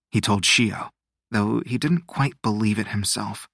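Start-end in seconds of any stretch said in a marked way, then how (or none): none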